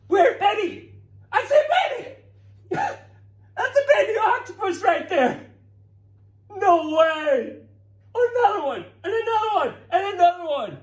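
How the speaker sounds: high, loud squeals of delight; excited shrieks. Real, not acted. Teacher squealing to kids as baby octopuses hatch.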